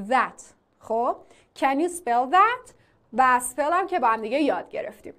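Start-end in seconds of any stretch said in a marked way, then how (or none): 0.1-0.4 THAT with a buzzing th, close to z; voiced th. Shown as a demonstration. Teacher repeating the correct sound, "that" with buzzing voiced th. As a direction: start "that" with voiced th, not d.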